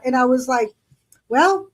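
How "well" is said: cheeky, laughing comeback; sassy reply with a laugh; defiant sass. Retold or imitated.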